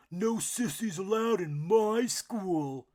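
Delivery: loud, slow bully duck voice; bully squawk. A narrator's reading. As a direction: block the path and sneer. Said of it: "Drake squawked".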